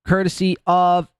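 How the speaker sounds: normal talking voice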